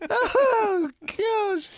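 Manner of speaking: long high laugh breaking into "Oh, gosh"; real cracking up; helpless laughter. Real, not acted. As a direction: laugh until it squeaks out. Laughing at the curry story.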